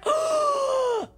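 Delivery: long high-pitched gasp; dramatic gasp. Real, not acted. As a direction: gasp in shocked protest. Dramatic reaction after outburst.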